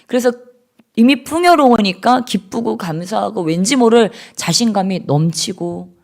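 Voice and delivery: normal talking voice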